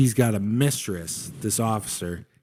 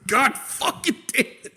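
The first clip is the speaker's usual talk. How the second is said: exasperated; 13 semitones higher than usual. Acted. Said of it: "He's like".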